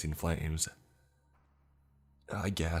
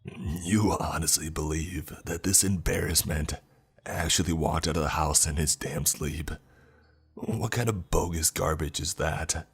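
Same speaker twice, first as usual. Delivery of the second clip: louder, scornful grandpa voice; angry accusation; 4 dB louder than usual. A narrator's reading. Grandfather accusing his grandson, loud and scornful. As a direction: spit contempt at the grandson.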